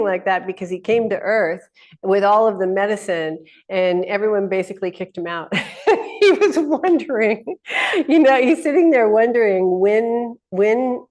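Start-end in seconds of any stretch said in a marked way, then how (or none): 5.5-11.1 laughing. Real, not acted.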